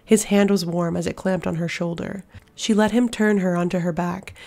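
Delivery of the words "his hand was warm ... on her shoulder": plain reading voice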